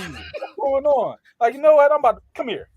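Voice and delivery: high, loud acted challenge; hammed-up exasperation; mock confrontation. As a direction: play someone riled up and about to step in. Acting out confronting the stranger.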